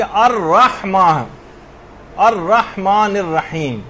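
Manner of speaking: الرحمن الرحیم slowly, with a clear throaty ح; careful correct recitation. Shown as a demonstration. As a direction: draw out the long vowels of الرحمن الرحیم. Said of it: "خاص طور پر اس بات کا خیال کرنا ہے".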